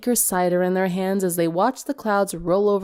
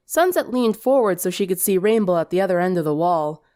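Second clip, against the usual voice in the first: ordinary delivery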